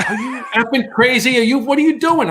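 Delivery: high-pitched incredulous scolding; acted outrage. Cop demanding answers from the intruder, mock outrage.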